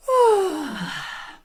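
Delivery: high yawn sliding down into a loud sigh; open-throated yawn into a falling sigh. Shown as a demonstration. Voice coach shows a class a yawn into a sigh, yawning sigh. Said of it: "it's gonna be something like this".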